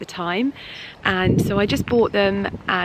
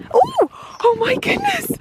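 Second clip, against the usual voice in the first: much higher, breathless real astonishment; overwhelmed surprise. Real, not acted. Spotting the asparagus in the garden, real high-pitched astonishment. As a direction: gasp it out as the surprise hits. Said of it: "that was a bit overwhelming".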